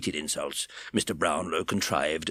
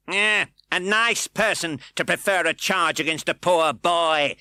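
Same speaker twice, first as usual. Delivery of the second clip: mock humane; 10 semitones higher, 6 dB louder than usual. A narrator's reading. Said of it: "comical effort to look humane".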